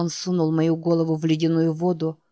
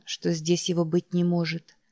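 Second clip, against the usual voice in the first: plain reading voice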